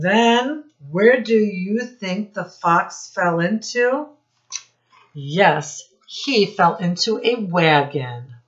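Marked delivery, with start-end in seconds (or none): none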